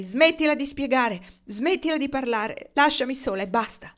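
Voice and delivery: wife still shouting at her husband. A narrator's reading. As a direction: keep yelling him away. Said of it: "gridò lei".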